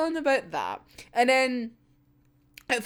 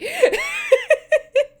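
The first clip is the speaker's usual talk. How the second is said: loud high giggle; squeaky laugh; 6 dB louder than usual. Real, not acted. Laughing about Fyodor's death.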